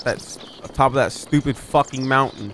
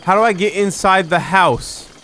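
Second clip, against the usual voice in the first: raised, loud demand, falling at the end; exasperated shout; 6 semitones higher, 5 dB louder than usual. Real, not acted. Player stuck outside the house, exasperated shout. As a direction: call it out high and fed up.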